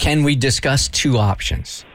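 slow, careful, collaborative wording; slow deliberate phrasing. Shown as a demonstration. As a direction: spell it out slowly for dictation.

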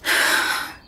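long tired breath out; weary sigh. Acted. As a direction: sigh before a big day. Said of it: "Zoe sighs".

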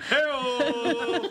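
lightly voiced TV announcer call; a hint of a talk show sidekick; Ed McMahon. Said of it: "it always comes out like Ed McMahon".

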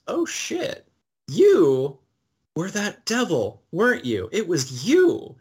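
acted-out shock, pitch leaping then punching "you"; retold dawning realization. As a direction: retell a stunned discovery, piece by piece. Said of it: "Johanna realizes".

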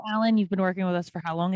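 ordinary talk

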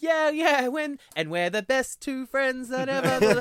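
high, loud, slow fake-friendly gushing; sing-song put-on buddy voice; mock chummy. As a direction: ham it up like best pals showing off to a crowd. Mocking fake friendly cover story.

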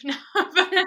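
high, loud burst of laughter; laughing. Real, not acted. Laughing at her own joke about her social handle.

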